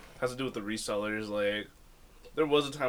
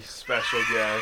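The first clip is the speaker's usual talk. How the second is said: slow announcer reveal; much slower than usual. Acted.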